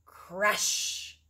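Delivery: usual speaking voice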